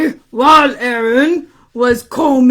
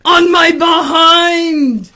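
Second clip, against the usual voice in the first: louder, slow, drawn-out punchline; exclaimed reveal; 4 semitones higher, 5 dB louder, slower than usual. Acted. Man admitting where hair grows, loud drawn-out dismay. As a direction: raise the voice and stretch each word.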